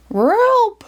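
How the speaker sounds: very high cartoon dog yelp; Scooby crying out. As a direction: squeal for help like a scared dog. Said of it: "Scooby cried".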